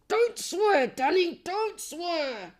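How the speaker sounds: high, mock-stern telling-off of himself; playful mock reprimand; self-scolding. Scolds himself not to swear.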